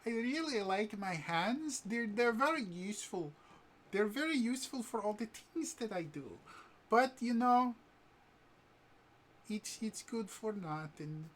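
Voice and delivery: high, far-off girlish voice, mock-sad; hammed-up sad sweet girl voice; sad Jester voice. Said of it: "He's like".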